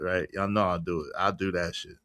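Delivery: normal talking voice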